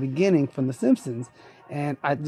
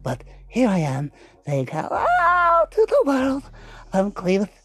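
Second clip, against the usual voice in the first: squeaky goofy voice with high shrieks; Clevis character voice; 7 semitones higher than usual. Acted. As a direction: play a dim-sounding oddball, pitch leaping. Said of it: "what Clevis is supposed to just say".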